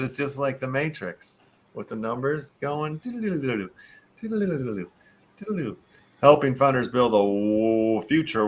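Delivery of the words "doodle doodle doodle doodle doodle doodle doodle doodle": higher, playful doodle sound effect; mock computer noise. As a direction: imitate scrolling code with your voice. Imitating Matrix numbers scrolling.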